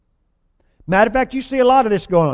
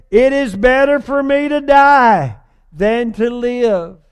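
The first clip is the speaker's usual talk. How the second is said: higher and slow, drawn-out self-pity; milk the complaint; mock whiny Jonah; 4 semitones higher, 3 dB louder, slower than usual. Preacher voicing sulky Jonah begging to die. Jonah's wish voiced again.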